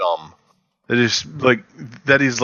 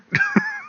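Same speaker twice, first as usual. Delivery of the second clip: laugh; 5 dB quieter than usual. Real, not acted. Reacting to Kojima getting fired.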